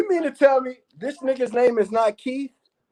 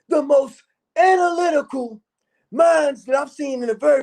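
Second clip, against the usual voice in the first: hyped street character; 4 semitones higher than usual. Acted. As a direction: hype up everyone in the chat like a rowdy hype man. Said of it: "get stuck in character".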